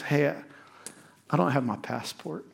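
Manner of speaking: softly imitated sheepish admission; quiet confession. Girl telling trip leader her passport is missing, quiet confession.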